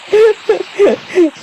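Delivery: sudden high burst of laughter. Real, not acted. Gamer laughing at his own near-miss.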